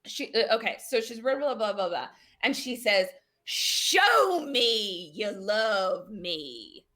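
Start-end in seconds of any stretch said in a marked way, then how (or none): 3.4-6.9 very slow, drawn-out demand in a put-on rural accent. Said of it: "this accent's really coming to me".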